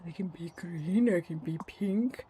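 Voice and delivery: breathless soft whisper of delight. Real, not acted. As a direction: whisper it like a secret treat.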